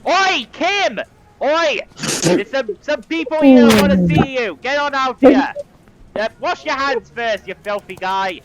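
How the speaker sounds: loud, high shout through a door; a clerk yelling into the men's room; shouting into the bathroom. Acted. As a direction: bellow for Kim across the room. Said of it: "opens the door and shouts in".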